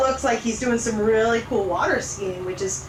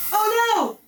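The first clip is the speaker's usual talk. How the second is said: alarmed; 10 semitones higher than usual. Acted. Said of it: "And then he goes".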